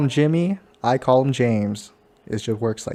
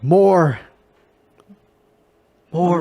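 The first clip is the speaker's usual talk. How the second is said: loud, raised demanding cry; Kylo Ren; 4 semitones higher, 4 dB louder than usual. Acted. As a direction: demand it like a villain. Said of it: "I'm like Kylo Ren".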